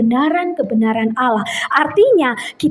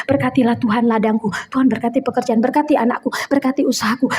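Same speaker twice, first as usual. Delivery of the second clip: low, repetitive run of petitions; mock rote praying; 5 semitones lower, 3 dB quieter than usual. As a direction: parody a self-centred prayer, reeling off requests. Mimics rote list of blessing requests. (menirukan doa hafalan yang beruntun dan rendah)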